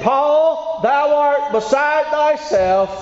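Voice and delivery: high, slow proclaiming cry; loud voice. Acted. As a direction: call it out high as Festus accusing Paul. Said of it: "he said with a loud voice".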